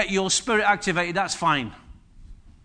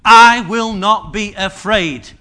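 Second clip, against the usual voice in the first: slow and loud, each word set down; bold declaration; 9 dB louder, slower than usual. Shown as a demonstration. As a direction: proclaim without fear. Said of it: "Threefold confession".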